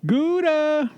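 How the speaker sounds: Gouda sung out high with falling tune; high sing-song call. Real, not acted. Calling pet, much higher.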